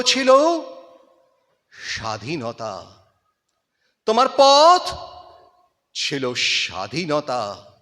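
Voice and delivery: dramatic Bengali recitation, rising to loud peaks and dropping to near silence; impassioned recitation with swelling climaxes and hushed falls; poem recitation. Acted. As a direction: declaim it like a stage tribute to a fallen hero.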